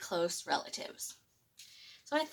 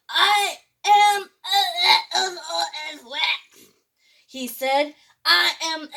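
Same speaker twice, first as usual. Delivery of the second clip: strained roar; 7 semitones higher, 9 dB louder, slower than usual. A narrator's reading. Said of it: "Tyrannosaurus tried again".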